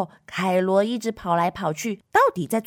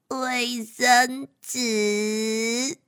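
giggly answer; 2 semitones higher, much slower than usual. Acted.

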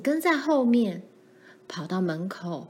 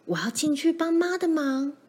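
put-on higher-pitched, urgent child's voice; anxious resolve; 7 semitones higher than usual. A narrator's reading. Laura resolves to go help mother, anxiously determined. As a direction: announce it with frightened determination.

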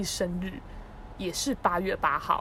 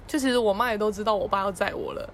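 normal talking voice

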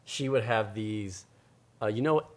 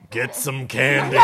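loud laughing smoker's rasp; 3 semitones higher, 10 dB louder, slower than usual. Pretend. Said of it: "She got a smoker's voice".

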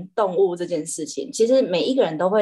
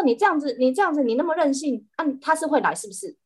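higher and fast, sarcastic telling-off; irritated scolding; 7 semitones higher, faster than usual. Retold or imitated. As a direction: snap at a spoiled cat, fed up. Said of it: "我就很不爽". (不爽地快速数落猫咪)

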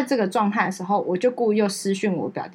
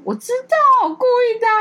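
much higher, louder teasing retort; cheeky big sister; 5 dB louder than usual. Retold or imitated. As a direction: fire it back high and smug. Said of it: "我姐就马上说".

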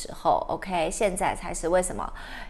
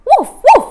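high, loud yappy woofs; frantic puppy barks; 16 dB louder than usual. Acted. Biscuit barking nonstop in fright, frantic high barks. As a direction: bark wildly like a scared puppy. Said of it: "Biscuit就开始一直乱叫".

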